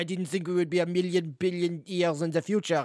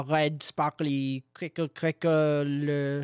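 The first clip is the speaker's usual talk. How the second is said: imitating static; much slower than usual. Shown as a demonstration. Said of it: "That's what static sounds like".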